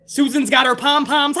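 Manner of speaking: ordinary delivery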